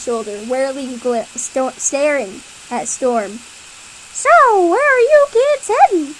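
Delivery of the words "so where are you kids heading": high, loud cat voice. Acted. Cat asking the kids where they're going, high and loud. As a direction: ask brightly in the cat voice. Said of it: "asks Rover".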